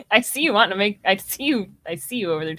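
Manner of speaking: usual speaking voice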